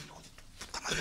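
whispered, held-back curse; barely voiced mock swear at his son; under his breath. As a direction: swallow the insult in a whisper. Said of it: "esforzándome por no decir".

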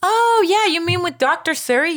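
high-pitched dawning realisation; sudden recollection. Retold or imitated. Woman suddenly remembers the doctor, brightly surprised. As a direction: imitate someone suddenly remembering, voice jumping up.

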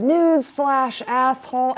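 high mock yell; hotheaded shouting. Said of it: "Dennis says".

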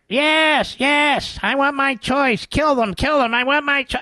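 high falsetto protester caricature; mocking protester voice. Host mocks abortion protesters on the court steps, high falsetto. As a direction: chant it in a shrill mocking falsetto. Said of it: "the anti-babyists are there".

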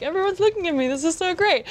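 put-on baby in the wedding line enjoying attention; toddler's thoughts. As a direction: voice a proud toddler showing off.

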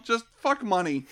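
usual speaking voice

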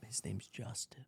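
discreetly whispered name; hushed, voiceless tip-off. Retold or imitated. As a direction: slip the name out under your breath. Girlfriend quietly passes on the name.

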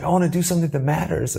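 half-reenact the plea to the sky; mock yell at sky. Calling his vow up to the sky, a mild mock yell. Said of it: "I screamed at the sky".

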